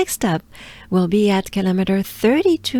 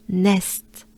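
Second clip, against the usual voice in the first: nest in a French accent. Shown as a demonstration. Teacher modelling the town's name, nest with French accent. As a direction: give nest a French pronunciation. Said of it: "with a French accent".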